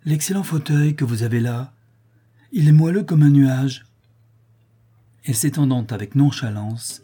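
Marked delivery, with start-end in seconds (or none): 0.0-3.8 contented, louder at the end; delighted praise of the chair; blissful. Acted. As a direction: savour the softness of the chair. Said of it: "avec un sourire de béatitude".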